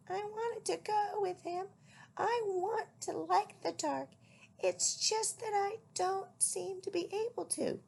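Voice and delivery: high small owlet voice; the worried baby owl, pitched high. A narrator's reading. Owlet confiding in his mother, high and small.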